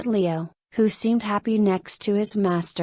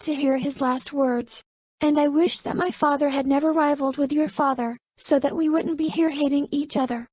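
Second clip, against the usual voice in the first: higher, bitter and regretful woman's voice; regretful young woman; 7 semitones higher than usual. Acted. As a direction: let the hurt show under the pride. Narrator voices Camelia high.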